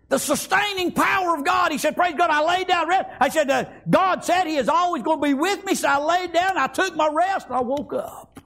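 full-voiced, driving sermon delivery; fervent preaching. Real, not acted. Preacher celebrating God's sustaining power, fervent preaching. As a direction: preach it out in surging phrases.

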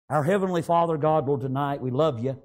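low, subdued praying voice; prayer. Real, not acted. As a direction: pray it low to God. Leads the closing prayer.

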